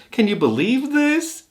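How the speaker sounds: very high, slow teddy bear voice. Retold or imitated. A teddy bear asks Dean about the news, in a very high squeak. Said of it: "the bear says".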